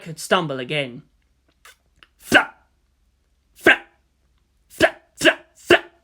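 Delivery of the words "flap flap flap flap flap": sharp voiced flap sounds, one by one; flapping sound. A narrator's reading. Narrator sounding out the wings, loud flaps. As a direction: bark out each flap like wingbeats.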